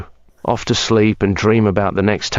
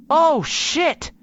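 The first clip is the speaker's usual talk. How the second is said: startled yelp. Real, not acted. Startled by the dog.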